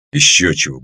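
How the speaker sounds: low, gruff little dismissal; a touch of surly brush-off; grumbled. A narrator's reading. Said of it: "буркнул".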